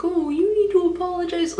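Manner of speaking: high, mock-stern telling-off; hammed-up parental scolding; imitated scolding mom. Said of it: "she started tearing into me also".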